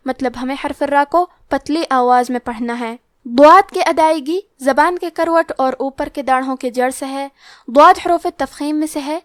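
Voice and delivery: ordinary talk, nothing marked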